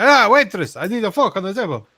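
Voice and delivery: high, loud mock Italian accent. Italian tourist asking the waitress for a fork, mock accent. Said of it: "the Italian".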